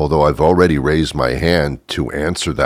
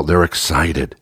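ordinary delivery

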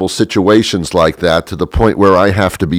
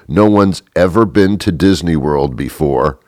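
ordinary talk, nothing marked

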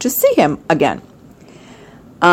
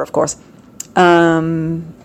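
ordinary delivery

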